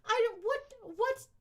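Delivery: high startled what; baffled high-pitched question; confused surprise. Real, not acted. Confused by lisp suggestion.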